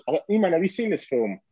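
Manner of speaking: normal talking voice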